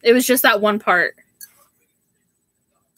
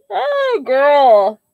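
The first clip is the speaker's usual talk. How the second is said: excited squeal; 3 semitones higher, 4 dB louder than usual. Real, not acted. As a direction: squeal with delight at a viewer's news. Thrilled reading viewer's gift comment.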